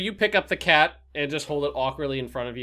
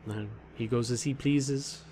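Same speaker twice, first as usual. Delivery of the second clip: slow, quiet, aloof cat voice; a mysterious cat speaking slowly; 2 semitones lower, 7 dB quieter than usual. Acted.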